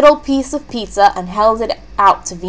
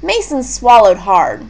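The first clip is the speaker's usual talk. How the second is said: plain reading voice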